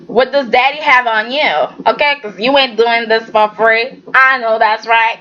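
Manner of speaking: ordinary talk, nothing marked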